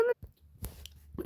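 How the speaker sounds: slow breathy whisper. Real, not acted. Disappointed near miss.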